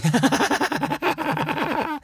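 high, long cackling laugh; appalling laugh. A narrator's reading. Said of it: "with an appalling laugh".

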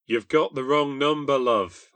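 loud correction over static; shouted. Acted. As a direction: shout so she can hear. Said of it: "I shouted".

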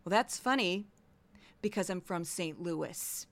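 quiet, low dry comeback; deadpan reply. Acted. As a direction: answer the teacher drily and quietly. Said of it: "I'd look at her and say".

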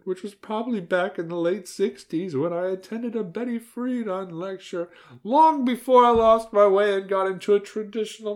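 a chatty grandmother pouring out her past; old grandmother confessing. Acted.

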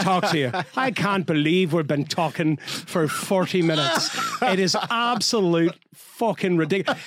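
imitating an Irish accent on the phone; thick mock Irish lilt, amused; [name]. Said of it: "you have to hear her call somebody in Ireland".

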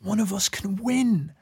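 high, stunned gasp of disbelief; the whole crowd suddenly daring to hope; amazed disbelief. Retold or imitated. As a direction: act like you can't believe your ears. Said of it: "all the Irish are like".

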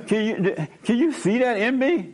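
high, laughing imitation of an amazed woman; mock tearful woman. Pastor imitating a psychologist at dinner, high and laughing.

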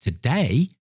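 high, incredulous question; astonished boy. Acted. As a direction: echo it back as a puzzled lad. Astonished boy answers Scrooge.

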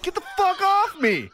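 high shouted get-off; high angry mock yell; retold yell. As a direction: yell it while being choked. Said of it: "and I'm like".

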